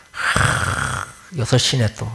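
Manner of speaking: an unvoiced sigh, then a low grumble; weary waking sigh. Groggy sleeper groaning at the early hour, sighing. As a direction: sigh like someone dragged awake at six.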